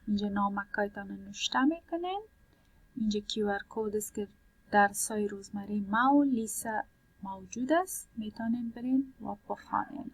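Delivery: usual speaking voice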